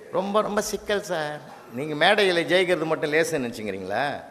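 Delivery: usual speaking voice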